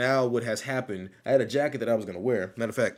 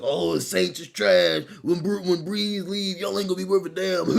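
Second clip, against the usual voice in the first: mocking bandwagon fan; 8 semitones higher, 5 dB louder than usual. Streamer mocking fans who trash the Saints, in a taunting sneer. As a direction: ham up a smug fan trash-talking the Saints.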